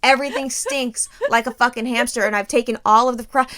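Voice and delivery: ordinary delivery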